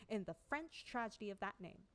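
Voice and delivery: plain reading voice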